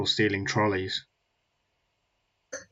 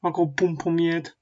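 put-on Birmingham accent; 7 semitones higher than usual. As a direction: parody a Birmingham accent, pitched well up. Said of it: "in their little Birmingham accent".

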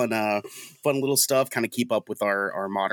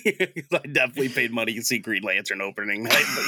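ordinary talk

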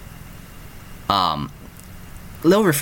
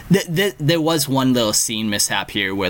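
normal talking voice

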